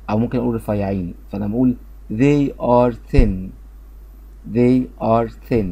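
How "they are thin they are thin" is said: "they" with voiced th and "thin" with unvoiced th, said slowly; slow model of "they are thin" for the th sounds; th sounds shown. Shown as a demonstration. Teacher slowly models the two th sounds. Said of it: "تي اتش بتاعت they منطوقة ذال".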